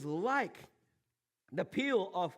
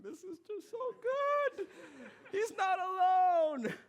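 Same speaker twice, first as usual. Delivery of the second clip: mock crying; 12 semitones higher, 3 dB louder than usual. Rewatching the scene on YouTube, mock crying. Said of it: "I don't know why I'm crying".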